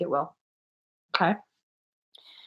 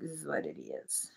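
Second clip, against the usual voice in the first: whisper; 8 semitones lower, 20 dB quieter than usual. Real, not acted. Muttering to herself.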